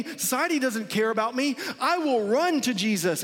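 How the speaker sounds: a hint of a broken, pleading seeker; needy sinner.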